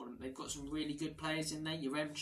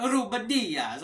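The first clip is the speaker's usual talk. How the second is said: Ruben Dias with a hammed-up Italian flair, higher and louder; Italian accent; 7 semitones higher, 12 dB louder, slower than usual. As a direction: roll it out like an Italian commentator. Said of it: "saying it like an Italian".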